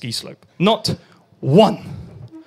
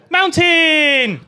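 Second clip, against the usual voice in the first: mock alarm; 8 semitones higher, 3 dB louder than usual. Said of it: "ending abruptly by going".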